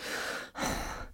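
a short, soft, breathy annoyed sigh. A narrator's reading. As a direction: sigh out your irritation, barely voiced. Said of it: "gave an annoyed sigh".

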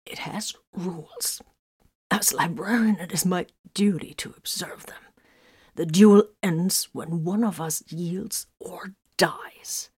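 hoarse, breathless whisper, slow; strained whisper. A narrator's reading. Wounded librarian lays down the rules, whispering hoarsely. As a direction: force out words through suppressed coughs. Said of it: "Her voice bubbled oddly under suppressed coughs".

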